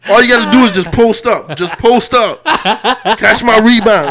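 loud, high mock bossy teammate; hammed-up shouted impression of a ballplayer; imitating a friend. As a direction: call out court orders as your loud buddy. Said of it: "That sound like him".

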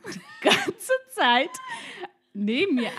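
laughing as she tells her co-host. Real, not acted. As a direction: laugh through the story. Laughing while telling.

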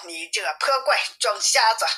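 plain reading voice

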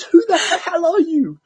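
very high, loud, drawn-out challenge; mock angry outburst. Imitating the angry man confronting him, mock-outraged yell. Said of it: "he did it so angrily".

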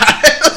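bursting into high laughter; a sudden high laugh. Real, not acted. Big laugh at the joke.

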